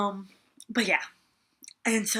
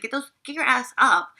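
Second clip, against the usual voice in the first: retold bossy yell, a bit louder; mock scolding; 3 semitones higher, 7 dB louder than usual. To Garrett kneeling in the dirt, a retold bossy yell. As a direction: snap him up off the ground. Telling him to stand.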